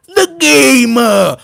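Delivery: drawn-out, loud nickname; mock announcer. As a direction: stretch it out like a hammed-up entrance call. Mock ring-style nickname for boss.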